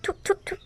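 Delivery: repeated "thu" spit noises; short, quiet mock spitting; spitting out food. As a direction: spit the bad bite out in disgust. Spits out food she dislikes.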